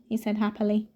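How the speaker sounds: plain reading voice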